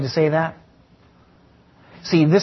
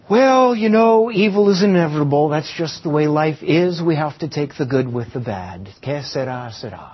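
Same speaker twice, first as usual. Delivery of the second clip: resigned fatalism. Acted. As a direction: shrug it off, letting the voice fade out. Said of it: "an Eastern way of thinking".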